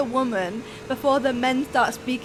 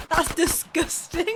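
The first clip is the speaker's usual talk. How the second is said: slow, higher, drawn-out mock revulsion; mock disgust; 6 semitones higher, slower than usual. Everyone hearing she's from Birmingham, mock disgust. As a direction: imitate an appalled stranger, stretching the words.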